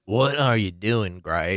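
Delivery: slow, low, disappointed drawl; imitated union rep. Union rep questioning Grace, imitated slow reproach.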